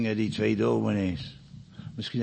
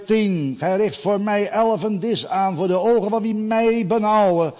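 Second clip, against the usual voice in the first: loud, raised preaching voice counting the psalm words; preaching at full force; 7 semitones higher, 6 dB louder than usual. Acted. Preacher counting Psalm 23 words to the congregation, loud and rising. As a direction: proclaim each line to the congregation, voice climbing.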